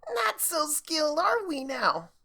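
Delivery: high, slow, taunting gloat. Acted. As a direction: rub it in their face. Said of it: "Rainbow gloated".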